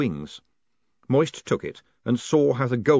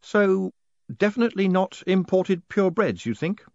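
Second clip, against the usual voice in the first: distant, preoccupied, pitch raised; faraway voice; 9 semitones higher than usual. A narrator's reading. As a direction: speak as if your mind has drifted off. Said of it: "in a faraway voice".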